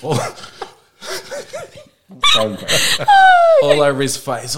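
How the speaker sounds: ordinary talk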